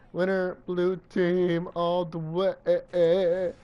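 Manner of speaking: slow, drawn-out victory announcement; announcer voice. Pretend. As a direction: stretch every word like a ring announcer. Announcing his own win.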